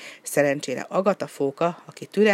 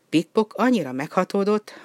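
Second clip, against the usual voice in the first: ordinary delivery, nothing marked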